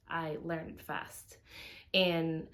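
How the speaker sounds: normal talking voice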